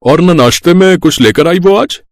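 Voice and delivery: put-on high, fussy old man's grumble; an old man whining that the tea is unsweetened; complaining father-in-law. (फीकी चाय पर शिकायत करते ससुर की ऊँची, चिड़चिड़ी आवाज़)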